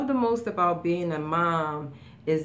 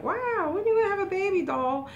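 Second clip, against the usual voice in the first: much higher, sing-song teasing friend; nosy girlfriends; 14 semitones higher than usual. Retold or imitated. Mother retells girlfriends teasing her, high sing-song mock voice. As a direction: mimic the friends nagging her about babies. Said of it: "everybody was like".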